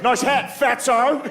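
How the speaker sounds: high-pitched Australian heckle; mocking Australian jab in a high voice; Australian accent. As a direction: toss off a high Australian taunt. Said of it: "he's a goth from Australia".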